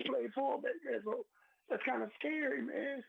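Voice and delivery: very high, choked-up voice; real tearful emotion. Real, not acted. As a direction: fight back real tears. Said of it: "I'm getting emotional".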